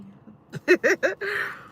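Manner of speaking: high laughter rising at the end; giggle, a little embarrassed; real laugh. Real, not acted. Laughing to viewers that she wants no gifts from the cats.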